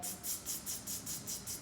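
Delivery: whispered mocking tsk tsk from cheeky monkeys. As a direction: tut at him like a teasing monkey. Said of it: "the monkeys just shook their fingers and said".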